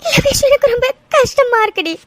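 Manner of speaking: tearful plea, slowing into sobs; crying. Acted. Girl crying to her friend about her missing love, tearful. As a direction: voice breaking with tears. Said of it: "இன்னும் எவ்வளவு நேரம் தாண்டி அழுதுட்டே இருக்க போற?".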